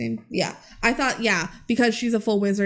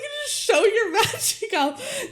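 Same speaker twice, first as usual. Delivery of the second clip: much higher, laughing sarcasm; real laughter, sarcastic; 11 semitones higher than usual. Real, not acted. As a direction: giggle through it, amused. Laughing at plot.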